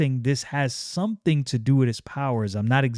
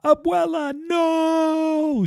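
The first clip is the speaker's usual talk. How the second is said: high, drawn-out mock scream; much slower than usual. Stefania screams as her grandmother dies, very high. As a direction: cry out for grandma in despair. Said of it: "Stefania scream out".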